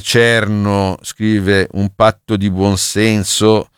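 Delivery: ordinary talk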